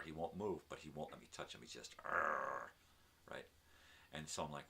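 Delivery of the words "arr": imitated growl. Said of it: "So he growls at me".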